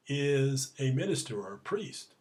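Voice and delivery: ordinary delivery, nothing marked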